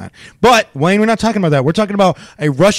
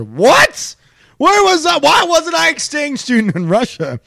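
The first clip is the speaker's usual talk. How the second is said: higher, rising disbelief; mock indignant surprise; 11 semitones higher than usual. Real, not acted. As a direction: play it up, jealous and incredulous. Mock outrage at story.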